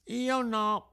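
high, breathless little stammer; stammered denial. A narrator's reading. Pinocchio, out of breath, denies it to the police. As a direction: stammer it out, scared. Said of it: "balbettò il burattino".

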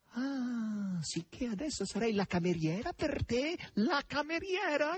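high, scornful questions; offended maid's high-pitched cry; indignant shouting. A narrator's reading. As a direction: challenge him up high, stretching the final question. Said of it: "gridava Natascia".

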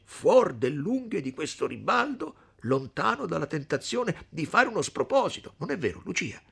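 high, animated character voice; eager suitor Renzo. Acted. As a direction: press the case with rising eagerness. Renzo speaks, acted by narrator.

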